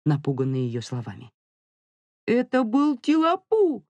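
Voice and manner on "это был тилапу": put-on high, drawn-out child voice; defiant kid digging in; stubborn little boy. A narrator's reading. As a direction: protest slowly in a much higher child's voice. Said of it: "С удвоенным упрямством принялся защищаться Мило".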